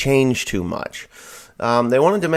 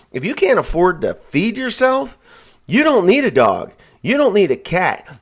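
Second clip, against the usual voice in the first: loud, higher, outraged rant; angry rant; 8 semitones higher, 6 dB louder than usual. Real, not acted. Ranting at viewers about welfare paying for dogs, loud and angry. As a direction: vent full force at the waste.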